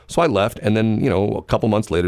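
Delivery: ordinary talk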